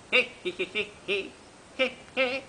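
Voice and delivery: short, choppy piglet cries; imitated pig shouts, rising at the end; squealing piglets. A narrator's reading. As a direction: squeal like a bunch of little pigs. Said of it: "y todos gritaron".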